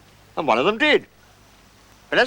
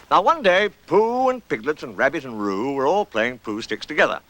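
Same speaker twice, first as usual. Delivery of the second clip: ordinary delivery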